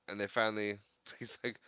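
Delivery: ordinary delivery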